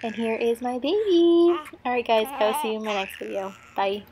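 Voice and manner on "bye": ordinary talk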